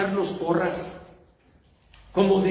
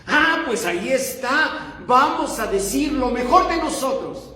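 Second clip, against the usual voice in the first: higher-pitched eager conclusion, acted; mock realization; 7 semitones higher than usual. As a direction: play someone who thinks they have got it. Voices a listener's eager misunderstanding.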